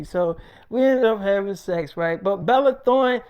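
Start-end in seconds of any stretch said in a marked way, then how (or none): none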